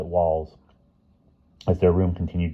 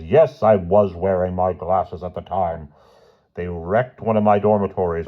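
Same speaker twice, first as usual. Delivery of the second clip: indignant headmaster; 4 semitones higher, 3 dB louder than usual. A narrator's reading. Headmaster ranting on phone.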